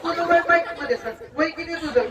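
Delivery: ordinary talk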